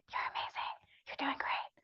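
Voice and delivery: soft unvoiced encouraging whisper. Shown as a demonstration. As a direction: whisper praise as if to a student. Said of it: "whisper anything you wanna whisper".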